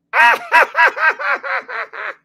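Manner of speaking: high, loud cackling shriek of a laugh; mock shrieking laugh of delight. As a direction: shriek with gleeful laughter like the neighbor. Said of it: "Marcy on the phone going".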